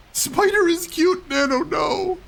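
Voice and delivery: high, loud cry of protest; high alarmed cry. Real, not acted. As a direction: yelp out the plea. Cries out at the spider.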